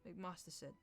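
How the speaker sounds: ordinary delivery, nothing marked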